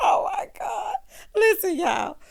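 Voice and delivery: high, laughing shout; laughing cry. Real, not acted. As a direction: still cracking up. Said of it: "I hollered so loud".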